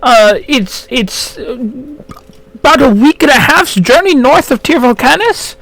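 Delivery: put-on high, helpful old gnome voice; old gnome shopkeeper.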